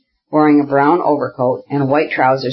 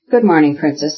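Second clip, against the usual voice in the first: usual speaking voice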